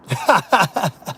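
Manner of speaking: long staccato cackle; laughing. Real, not acted. Mocking listeners over the job interview, laughing. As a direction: burst out in a rapid cackle. Cackling at his own scenario.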